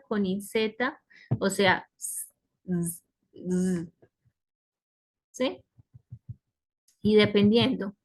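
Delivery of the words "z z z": drawn-out hissed and buzzed consonants; s and z sounds. Shown as a demonstration. Teacher showing the possible plural endings, isolated s and z sounds. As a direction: sound out the s and z alone. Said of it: "puedo pronunciarlo con S, con Z".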